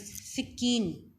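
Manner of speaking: सिक्कीन drawn out very slowly; slow teaching repeat. Shown as a demonstration. Teacher slowly repeats सिक्कीन for the children to learn. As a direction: stretch सिक्कीन out so they catch it. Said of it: "इस्तेमाल होता है knife".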